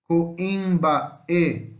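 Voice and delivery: COIMBAÊ split into syllables with gaps; teacher's careful syllable breakdown of Coimbaê. Shown as a demonstration. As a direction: sound out Coimbaê slowly, setting the final Ê apart. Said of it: "e aqui é separado".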